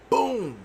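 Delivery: high imitated boom of a door kicked open. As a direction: punch out the door sound. Dad kicks door again.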